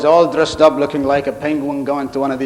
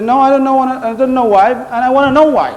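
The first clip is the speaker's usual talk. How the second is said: higher and louder, insistent; indignant; 7 semitones higher, 3 dB louder than usual. Retold or imitated. Worker pressing his boss for an answer, higher, louder and indignant. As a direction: demand an answer, fed up.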